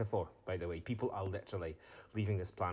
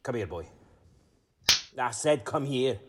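angry command; 3 semitones higher, 8 dB louder than usual. Acted. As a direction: lean on every word so the boy obeys. Father ordering his boy.

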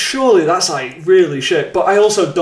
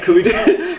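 normal talking voice

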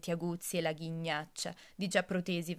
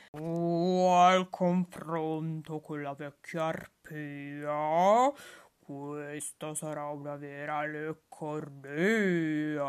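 slow, gloating wolf voice, louder at first; relishing wolf; 2 semitones lower, 6 dB louder, slower than usual. Acted. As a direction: lick your lips over the next meal. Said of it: "se la pregusta già con gran piacere".

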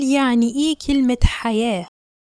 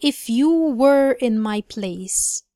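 slow careful reading; much slower than usual. Shown as a demonstration. Teacher reading a sentence slowly for the class. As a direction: read each word slowly and clearly. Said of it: "sentence number fifteen".

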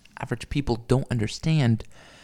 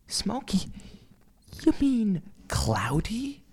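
high, drawn-out questioning disbelief; mock incredulous; 9 semitones higher, slower than usual. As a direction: stretch the question out high, mocking the word choice. Acted mocking query of her word.

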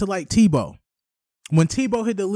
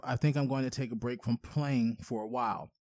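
mock teary quote; 7 semitones lower, 13 dB quieter than usual. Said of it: "tearing up".

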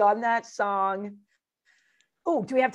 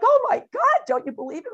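shocked disbelief; 6 dB louder than usual. Retold or imitated. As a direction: pitch jumps way up, louder on oh my god. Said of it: "I'm like".

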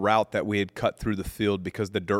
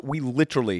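normal talking voice

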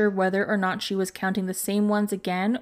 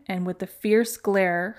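usual speaking voice

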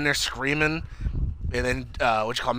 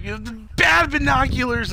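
high-pitched hammed-up scolding; comic high outburst, imitated; Jerry's mock rage; 12 semitones higher, 3 dB louder than usual. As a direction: scold the object in a high, cranky put-on voice. Jerry rages at his binoculars.

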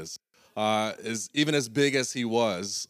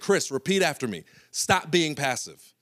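coach command; 5 semitones higher, 4 dB louder than usual. Retold or imitated. Coach making Chris repeat after him, higher and louder. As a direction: drill it in like a coach. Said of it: "he's like".

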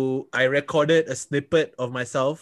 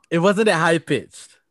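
1 drawn-out defensive protest; 3 semitones higher, 4 dB louder, slower than usual. Real, not acted. Defending himself, dragged out.

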